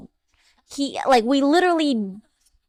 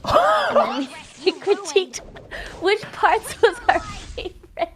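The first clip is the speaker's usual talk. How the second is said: giggly; 5 semitones higher than usual. Real, not acted. Giggling while telling.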